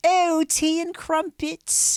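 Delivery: high, drawn-out mock-British accent; hammed-up royal English accent; posh English accent. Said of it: "Americans do a posh English accent".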